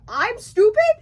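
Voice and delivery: high, loud offended comeback; indignant retort. Retold or imitated. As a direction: throw the insult back in disbelief. Hooper fights the mayor.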